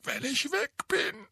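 choked, cracking up high; croaky voice. Acted. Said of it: "mit krächzender Stimme".

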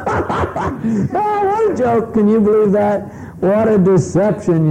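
high, laughing mockery of the husband's boast; mocking scoff. Guests leaving, mocking the husband's boast with a high laugh. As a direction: sneer at him, laughing behind his back. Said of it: "everybody when he leaves goes".

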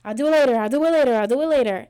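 loud, dismissive "I'll do it later"; dismissive brush-off. Retold or imitated. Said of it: "And I'm just like".

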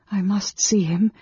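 a thought that suddenly strikes; sudden resolve. A narrator's reading. Woman alone decides to see the mage, suddenly resolved. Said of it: "she said to herself suddenly".

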